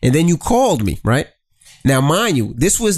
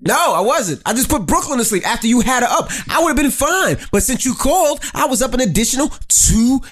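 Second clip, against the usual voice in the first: exasperated complaint; 9 semitones higher than usual. Real, not acted. Heated complaint at husband.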